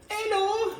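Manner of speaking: very high squeaky bird hello; Pablo's voice. Acted. The crow greets the sailor in a high squeak. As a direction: chirp it like a tiny crow. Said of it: "my little friend".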